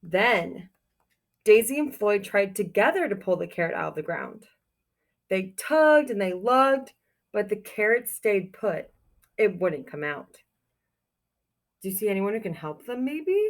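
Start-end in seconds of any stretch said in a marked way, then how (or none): 0.0-10.4 put-on reading a picture book aloud to children; storybook reading. A narrator's reading. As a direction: tell it like storytime for kids.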